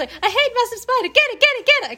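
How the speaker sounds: high, loud, shrieking panic; hammed-up spider panic, very high and loud; mock panic. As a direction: squeal it like you're terrified of a spider. Acting a panicked spider quote.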